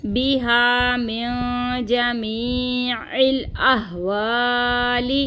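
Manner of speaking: slow recitation with a nasal ghunna and light lengthening; nasal ikhfa. Shown as a demonstration. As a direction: hum the nasal, stretch the vowel a little. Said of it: "इख्फा का गुन्ना होगा और यहाँ पे मद्दा को हम हल्का सा खींचेंगे".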